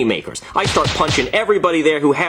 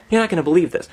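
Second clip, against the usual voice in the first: ordinary delivery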